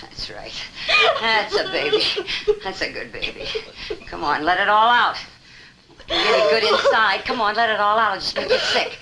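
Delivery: put-on high, hushed baby-talk cooing. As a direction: coo to her like soothing a child. Man cooing to a sobbing woman.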